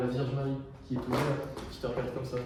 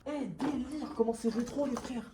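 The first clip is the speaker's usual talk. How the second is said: excited high voice; 9 semitones higher, 3 dB quieter than usual. Real, not acted. Excited at retro objects.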